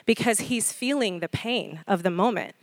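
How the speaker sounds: normal talking voice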